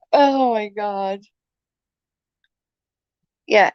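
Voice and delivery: normal talking voice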